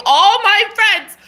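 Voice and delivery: "All my friends" high, loud and drawn out; indignant emphasis. Real, not acted.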